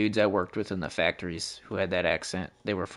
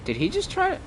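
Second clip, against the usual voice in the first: incredulous. Real, not acted. Reacting to another player.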